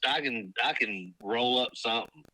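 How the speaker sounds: normal talking voice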